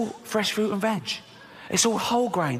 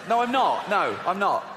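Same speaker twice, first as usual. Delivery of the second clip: usual speaking voice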